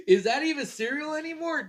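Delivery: high, laughing disbelief. Real, not acted. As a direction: laugh through the question. Laughs at soggy cereal.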